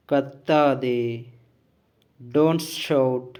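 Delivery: slow, deliberate drill delivery, each word set apart; measured, emphatic classroom read-out; language-lesson model reading. Shown as a demonstration. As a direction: read out each phrase firmly for learners to copy. Reads out model sentences for learners.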